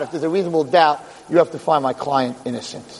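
ordinary delivery

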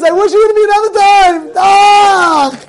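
loud, high, hammed-up crying; exaggerated bawling that ends in a cry; mock wailing. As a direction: wail it out in comic regret. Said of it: "you see the guy crying and crying".

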